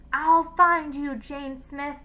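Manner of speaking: slow, high call into the distance; shouted threat. A narrator's reading. Teacher shouts into the darkness after her fleeing student. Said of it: "she shouted into the darkness".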